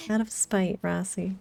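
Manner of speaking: ordinary talk, nothing marked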